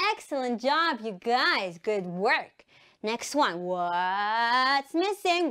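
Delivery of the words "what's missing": very slow, stretched question; suspense-building quizmaster call; drawn-out game call. Shown as a demonstration. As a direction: stretch "what's" right out. Game catchphrase called to children.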